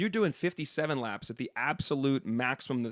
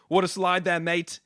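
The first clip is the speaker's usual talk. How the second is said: imitated Australian driver, higher and louder; heavy Australian accent; 5 semitones higher, 5 dB louder, slower than usual. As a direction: put on a broad Australian accent. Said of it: "there's this heavy Australian accent".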